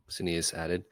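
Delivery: usual speaking voice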